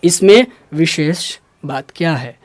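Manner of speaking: इसमें विशेष बात क्या है in separate chunks; measured, low teaching delivery of wishes; slow and clear. Shown as a demonstration. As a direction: pause round wishes, saying it slowly. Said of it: "हल्का धीरे धीरे होकर बोलिए".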